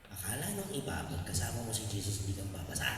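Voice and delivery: low, soft, almost whispered; quiet repeat. Acted. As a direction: bring it down to a hush. (mahinang pag-uulit)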